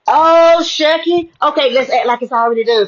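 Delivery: a gleeful yes to the voice; joyful exclaim. Retold or imitated. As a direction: burst out happily, then settle. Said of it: "smiling and laughing and just being so jovial".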